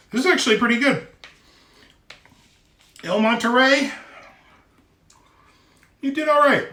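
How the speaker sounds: high, loud praise; enthusiastic. Real, not acted. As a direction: cheer the restaurant on. Praising the meal brand.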